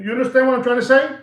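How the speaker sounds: ordinary talk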